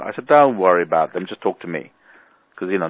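normal talking voice

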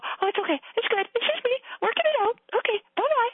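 lilting high chirpy okay-bye voice; breezy brush-off. Retold or imitated. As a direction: wave someone off cheerfully while crying. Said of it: "and I just go".

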